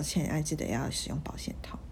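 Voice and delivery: whispered reminder; dad's hushed advice. Retold or imitated. Dad quietly telling his daughter to use condoms, whispered. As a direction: whisper it like a discreet father.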